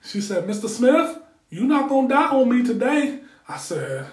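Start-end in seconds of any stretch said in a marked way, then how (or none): 0.5-3.2 high, loud Jamaican nurse voice; mock Jamaican nurse reassuring him; Jamaican accent. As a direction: Jamaican nurse promising he won't die. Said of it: "had a real good accent".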